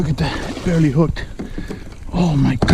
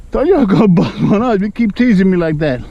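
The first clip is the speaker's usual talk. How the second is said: playful scolding; 6 semitones higher, 6 dB louder than usual. Real, not acted.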